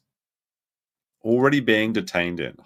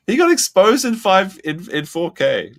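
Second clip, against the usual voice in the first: high, loud, half-laughing outburst; loud gleeful exclaim; 10 semitones higher, 8 dB louder than usual. Real, not acted. Streamer mocking prisoner's exposed phone, gleeful shout. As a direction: crow about the exposure.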